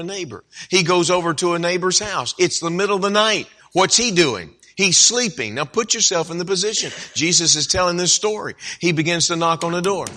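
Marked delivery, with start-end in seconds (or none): none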